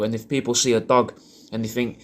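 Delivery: normal talking voice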